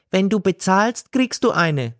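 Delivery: high, scolding woman voice; scolding seller. A narrator's reading. Gailana tells God to go home, high and scolding. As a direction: tell him off sharply.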